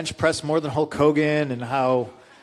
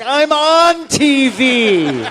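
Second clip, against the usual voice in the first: much higher, louder, very slow, through laughter; laughing retort; 10 semitones higher, 7 dB louder, much slower than usual. Retold or imitated. Speaker mocking Tony Atlas's threat, laughing. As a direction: gloat it out while cracking up. Retold comeback.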